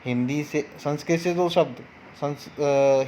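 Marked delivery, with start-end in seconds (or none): none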